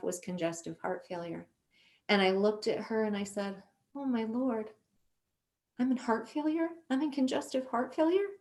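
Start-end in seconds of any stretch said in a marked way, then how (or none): 3.9-8.3 rising, softer questions of disbelief; retold shocked disbelief. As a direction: relive the stunned reaction, voice climbing higher. Reacting to a shock diagnosis.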